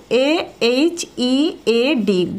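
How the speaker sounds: letters "A H E A D" read one by one; slow spelling. Shown as a demonstration. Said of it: "বলে ফেলো". (ধীরে বানান "A H E A D")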